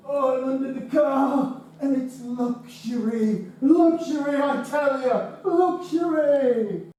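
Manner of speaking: high, hammed-up luxury voice; mock posh delight. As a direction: play it like a pampered aristocrat. Jokes lying under car is luxury.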